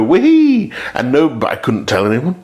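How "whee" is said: mock glee. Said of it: "I was like".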